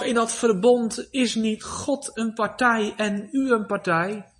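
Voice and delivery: usual speaking voice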